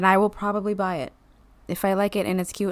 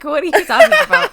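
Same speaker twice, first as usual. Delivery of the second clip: fast, high, louder disbelief; incredulous retort; 13 semitones higher, 10 dB louder, faster than usual. Retold or imitated. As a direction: blurt it out in disbelief. Retold own incredulous reaction.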